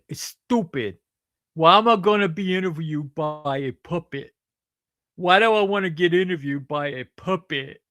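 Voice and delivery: a hint of a sneering fellow comedian; mock impression of a comedian. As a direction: lightly play the scornful comedian, mocking. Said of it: "Sheila, I really like that".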